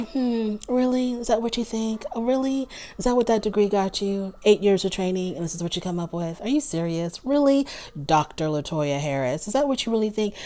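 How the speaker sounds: soft, high, sugary condescension, never raising the voice; condescending even tone. Retold or imitated. Vlogger mocking Christina talking down to LaToya, soft and condescending. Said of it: "She keeps her voice very even toned".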